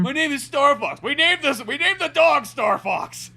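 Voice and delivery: high, loud slow protest; whiny Fox. Pretend. Fox correcting his dad, high loud whine.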